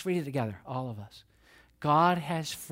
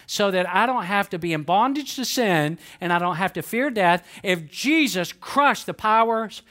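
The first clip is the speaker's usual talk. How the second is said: raised, emphatic preacher's voice peaking on Jesus' victory; impassioned preaching; 5 semitones higher, 6 dB louder than usual. Real, not acted. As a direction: build to a loud, high-pitched sermon climax. Preaches to a climax.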